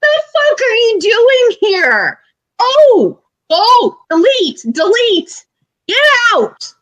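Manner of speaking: high, slow shrieking rant; mock outrage. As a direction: shriek him off the screen. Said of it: "And I'm like".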